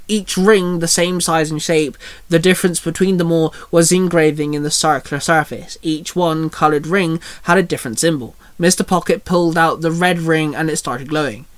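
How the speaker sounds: plain reading voice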